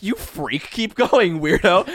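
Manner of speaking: loud, high teasing insult; mock insult. Friend teases the driver to keep going, loud mock insult. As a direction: yell at him to keep driving. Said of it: "I'm like".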